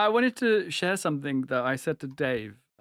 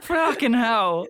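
laughing; 4 semitones higher, slower than usual. Real, not acted. Laughing at own swearing.

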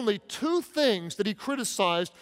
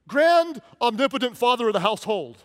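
pompous, louder mock grandeur through a laugh; mock formal child; 3 semitones higher, 5 dB louder than usual. Pastor imagining his kid addressing him grandly, laughing. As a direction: ham up a child's stilted speech. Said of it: "imagine if one of my kids".

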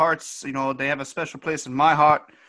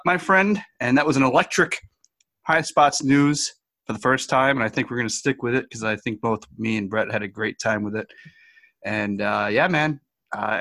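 normal talking voice

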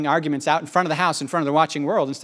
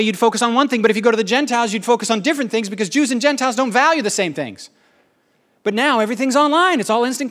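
fast, higher-pitched rush of explanation; talking fast; 5 semitones higher than usual. Real, not acted. Preacher hurrying through why online mission is hard, talking fast. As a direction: race through it on a rising pitch. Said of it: "I'm gonna talk fast".

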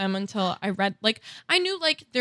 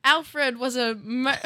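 put-on hesitant first try at a Liverpool accent; 3 semitones higher, slower than usual. Reading the history to a friend, a tentative Liverpool accent. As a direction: start Alfred in a shaky Liverpool accent. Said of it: "you have to do all of this in a British accent".

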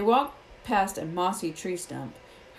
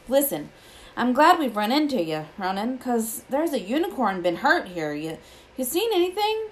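earnest gamekeeper, higher and louder; lightly voiced Hagrid; 6 semitones higher, 4 dB louder than usual. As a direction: press a friend for news, voice rising.

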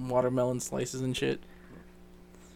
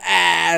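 loud, raised "ah" outburst; mock yell; 4 semitones higher, 10 dB louder than usual. Friends baffling the party with an in-joke, mock yell. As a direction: burst out with a loud nonsense "ah".

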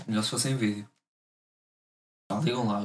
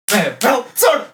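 rising, loud chant; excited shout; 8 semitones higher, 7 dB louder than usual. Real, not acted. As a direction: belt out the count. Rock paper scissors, rising excitement.

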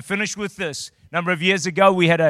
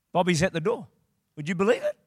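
normal talking voice

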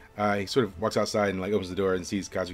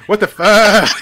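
shocked cry; 12 semitones higher, 14 dB louder, slower than usual. Acted. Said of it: "He says".

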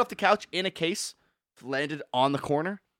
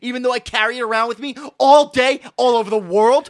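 louder, fed-up rant about glass; angry rant; 5 semitones higher, 8 dB louder than usual. Real, not acted. Friend ranting about fragile phone glass, louder and fed up. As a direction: vent hard at the phone makers.

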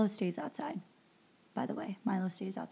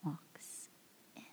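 slow suspenseful whisper; hushed, slowed-down reveal of the twist; dramatic hush; 8 dB quieter, slower than usual. Pretend. Dramatic reveal in movie recap.

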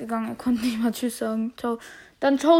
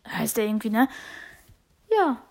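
ordinary talk, nothing marked

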